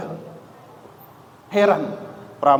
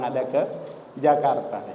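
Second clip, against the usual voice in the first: ordinary talk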